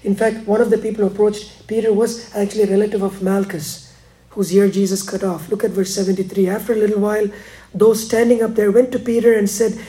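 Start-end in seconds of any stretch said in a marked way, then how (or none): none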